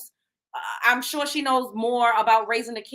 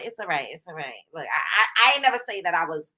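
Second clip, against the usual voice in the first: ordinary talk, nothing marked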